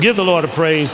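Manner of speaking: loud rallying preacher; forceful pulpit exhortation; call to praise. Real, not acted. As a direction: call out strongly over the congregation. Preacher calls congregation to praise.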